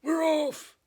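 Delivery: loud, very high cheer; shout. Acted. Crew shouts as they set sail, loud cheer. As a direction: yell it with the whole crew. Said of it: "we all shouted".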